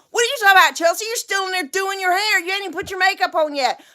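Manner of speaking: high, indignant husband imitation; mock bickering. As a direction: fire back in a married squabble. Acted quarrel, son retorts.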